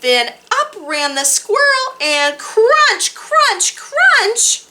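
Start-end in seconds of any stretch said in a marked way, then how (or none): 2.4-4.6 high, loud punchy crunches; crunch sound words. A narrator's reading. Reading the squirrel eating acorns, loud and punchy. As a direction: bite down on each crunch.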